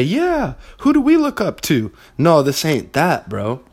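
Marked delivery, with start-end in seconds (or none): 0.0-1.9 high, sneering mock of an eager follower; mocking cult follower. Mocking the hero-worship crowd, high sneering mimicry. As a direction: ape a starry-eyed fan to ridicule them. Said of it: "part of your guys' cult and say".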